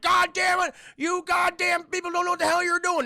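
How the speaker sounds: high, loud furious shouting; hammed-up coach tirade; yelling coach. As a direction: bawl out the team like an angry coach. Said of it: "the coach is yelling".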